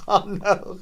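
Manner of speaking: ordinary delivery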